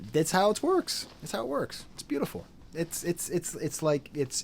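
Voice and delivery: ordinary talk, nothing marked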